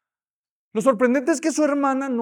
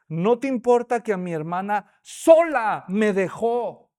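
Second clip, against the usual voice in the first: "sola" stressed and drawn out, slow and louder; emphasis on sola; 7 dB louder, slower than usual. Shown as a demonstration. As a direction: lean hard on the word "sola". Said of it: "es el énfasis".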